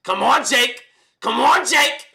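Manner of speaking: high, loud taunting shout; exaggerated impression of a fighter calling out a rival; Edgar Berlanga. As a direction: yell it as a high-pitched call-out. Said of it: "do the Edgar Berlanga impression".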